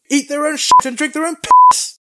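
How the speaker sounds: high, full-volume crude yell; loud, hammed-up brash jeer; brash bragging. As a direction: shout it as a swaggering taunt. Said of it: "You just get brash bragging".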